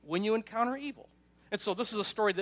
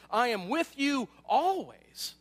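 slow, higher and louder, every word weighted; Jesus' promise; 5 semitones higher, 5 dB louder, much slower than usual. Acted. Jesus assuring his disciples, slow and emphatic. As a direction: lean on each word of the promise. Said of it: "but Jesus says".

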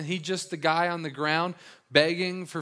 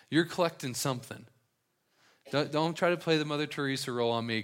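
usual speaking voice

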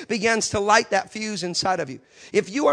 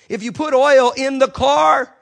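much louder, building preaching; forceful preaching; 4 semitones higher, 11 dB louder than usual. Real, not acted. Preacher warning men about car engines, loud build. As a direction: drive it home loudly.

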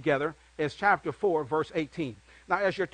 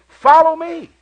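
much higher and louder, calling out Follow me; Jesus calling out; 10 semitones higher, 13 dB louder, slower than usual. A narrator's reading. Jesus calling fishermen to follow, ringing call. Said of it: "Then he said to them".

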